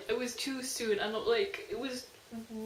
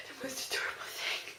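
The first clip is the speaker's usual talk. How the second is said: gushing whisper; 2 semitones higher, 4 dB quieter than usual. Real, not acted. Gushing over the couple.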